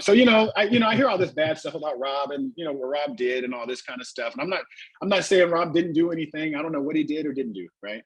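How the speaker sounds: usual speaking voice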